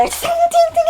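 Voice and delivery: high ringing ting of swords; 1 clashing blades. Retold or imitated. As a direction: ring out each ting like steel. Blades clashing sound effect.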